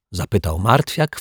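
plain reading voice

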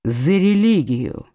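low, slow, dejected reply; sunken voice. A narrator's reading. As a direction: let the voice drop, dragging the words. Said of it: "упавшим голосом ответил Гис".

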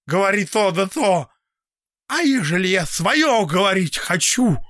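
put-on high, loud, bitter old man ranting. A narrator's reading. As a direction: rail against everyone. Old man's bitter rant.

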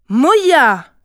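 loud, high shout with a falling end; angry shout. A narrator's reading. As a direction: shout the child's name in anger. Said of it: "cria Kijivu en colère". (cri de colère)

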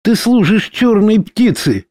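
high accusing shout. A narrator's reading. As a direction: hurl the accusation at him. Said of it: "выкрикнула Дора".

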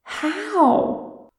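high-pitched squawk of disbelief. A narrator's reading. Kinsman astonished at being noticed, high squawk. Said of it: "Freyrin squawked".

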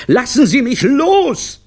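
drawn-out, very high, loud scream; desperate scream. A narrator's reading. Clown dangling in the air, long desperate scream. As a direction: scream it slowly, falling at the end. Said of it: "zappelte verzweifelt in der Luft".

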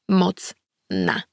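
very slow, each syllable of "pomocna" set apart. A narrator's reading. Badb repeating the word "pomocna" she was told, syllable by syllable. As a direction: spell out "pomocna" like a new word. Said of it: "Przesylabizowała to słowo".

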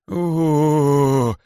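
long high howling wail; king's howl. A narrator's reading. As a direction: howl with outrage. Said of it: "завыл царь". (завывание царя)

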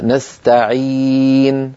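نستعين drawn out very slowly; slow recitation. Shown as a demonstration. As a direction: recite نستعين holding the long i. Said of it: "مثلًا". (تلاوة بطيئة)

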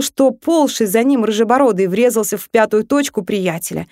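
ordinary delivery

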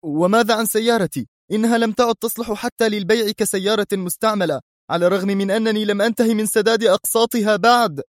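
put-on outraged young man shouting; angry protest. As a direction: complain hotly about the car. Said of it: "صاح الشاب في غضب واستنكار".

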